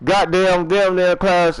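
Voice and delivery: ordinary talk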